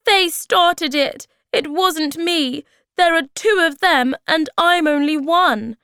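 put-on high, loud childish protest; crying out. A narrator's reading. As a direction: plead your innocence to mother, shouting. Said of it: "Moomintroll cried".

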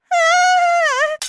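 very high, loud shriek of shock; scream. Acted. Mother seeing her husband's hair, screaming. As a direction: shriek in horror. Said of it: "Then she screamed".

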